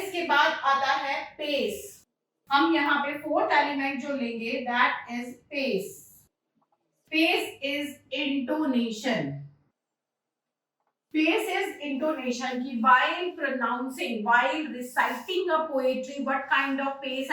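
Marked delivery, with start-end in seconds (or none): 4.7-12.6 very slow, spaced-out words; slow dictation. Real, not acted. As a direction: give the definition slowly for note-taking. Dictating definition while writing it. (बहुत धीमे, ठहर कर परिभाषा लिखवाते हुए)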